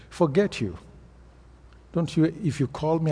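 usual speaking voice

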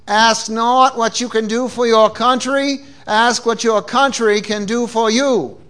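JFK-style Boston accent; measured Boston-accented oratory. Shown as a demonstration. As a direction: quote Kennedy in a broad Boston accent. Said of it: "I can say it in my Boston accent".

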